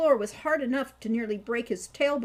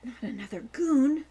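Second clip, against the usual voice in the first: anxiously; 3 semitones higher, 6 dB louder than usual. A narrator's reading. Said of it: "asked Falcon anxiously".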